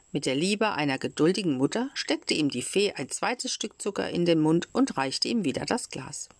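plain reading voice

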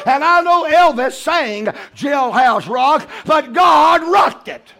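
high, loud, drawn-out preacher's shout; full-force preaching. Real, not acted. Preacher urging the church to keep coming, preaching at full force. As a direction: preach it hard, pushing every phrase up high.

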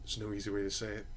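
somber doctor voice, quiet and grave; breaking bad news. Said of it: "takes that deep breath and goes".

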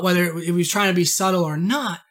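ordinary talk, nothing marked

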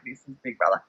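describing first hearing him, a low, soft imitation; Big Brother voice. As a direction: sink into the low, soft announcer tone. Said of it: "you hear him say that".

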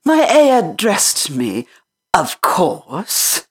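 put-on high, offended girl voice; how dare she suggest it; indignant little girl. Mary indignant.

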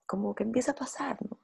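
soft whisper, letting it fade. Real, not acted. Describing a tantrum easing, whispered. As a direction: whisper it as it passes.

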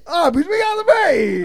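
high shouted ad line; yelled slogan. Acted. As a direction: bellow it like a commercial. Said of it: "Just start yelling it".

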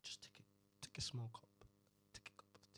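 breathy whisper of "take a cup of tea"; whispered advice. Pretend. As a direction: hush it like a calming secret. Mock soothing advice.

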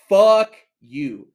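loud, punched first word; angry outburst. Acted. Storyteller cursing the clerk who spat, loud angry outburst.

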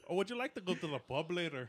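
high, loud Irish lilt; Irish accent. Acted. Winning an accent contest among friends, high Irish accent. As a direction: put on a bright-pitched Irish accent. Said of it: "Irish accent".

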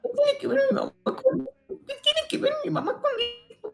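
very high, whiny mock whimper; whiny kid voice. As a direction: whine like an offended little kid. After "¿vas a llorar?" teasing. (voz de niño chillón, muy aguda)

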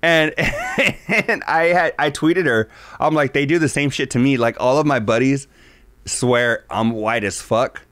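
usual speaking voice

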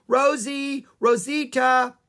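high, loud calling yell. A narrator's reading. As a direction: call the rhino from afar. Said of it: "Annie yelled".

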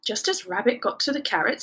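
ordinary delivery

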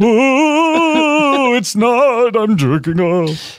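high, slow spooky ghost moan. Pretend. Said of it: "two ghosts trying to".

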